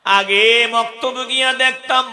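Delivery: preaching lament. Acted. Preacher to the faithful, mourning their fall, sung high and loud. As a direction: wail it like a waz lament over music.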